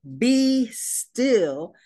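high, loud command to the sea; commanding Jesus. A narrator's reading. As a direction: order the sea to be still. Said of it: "he commanded".

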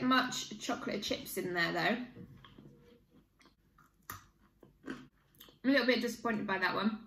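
speaking between bites; talking while chewing. Real, not acted. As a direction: mouth partly full of biscuit. Seen 1: Holds bitten biscuit, bites it mid-sentence around 1.2–2.6s.

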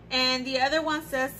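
ordinary delivery

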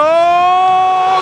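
long, very loud goal cry; goal scream. Real, not acted. Goal scored, long held scream.

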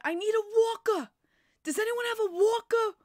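high, loud call down the hallway; retold loud call. Retells calling out at office.

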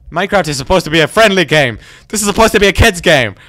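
loud complaint rising in pitch; panicked protest. Real, not acted. Attacked player protesting this is a kid's game, panicked protest.

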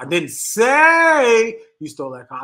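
"say" stretched long and loud; stress on SAY. Shown as a demonstration. Showing how inflection changes the sentence, stress on SAY. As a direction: drag out "say" loudly, then finish the sentence. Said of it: "put the inflection on a different word".

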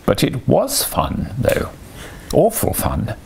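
muttered defiance, stretched out; a touch of sulky rebellion to himself; mutinous. A narrator's reading. Toad secretly defying the rat's lecture, mutinous. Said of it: "he kept saying to himself mutinously".

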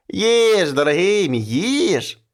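urging him to eat, sugary; pushy witch. Acted. Witch urging the man to eat, sugary and insistent. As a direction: press the food on him, fake-sweet.

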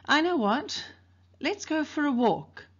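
high, breezy, overly casual offer; too casual. Acted. Dill to his friends, much too casually suggesting a walk. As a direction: sound innocent while hiding a plan. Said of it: "said altogether too casually".

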